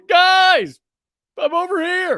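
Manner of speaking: high, loud mock call for help. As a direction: call out to friends from the water, nude.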